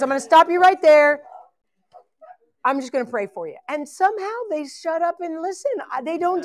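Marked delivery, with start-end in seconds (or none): 0.0-3.6 imitating a friend's in-your-face interruption, a bit louder and higher; blunt and direct. Friend Michelle stopping a sob story to pray. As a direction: cut them off, right up in their face. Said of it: "right up in their face".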